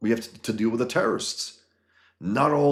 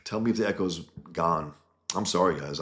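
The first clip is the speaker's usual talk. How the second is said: normal talking voice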